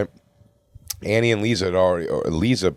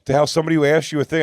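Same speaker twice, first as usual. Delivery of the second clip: higher, incredulous push back; mildly indignant, pitched up; baffled complaint; exasperated retort; 7 semitones higher than usual. Retold or imitated. Retold exasperated confrontation.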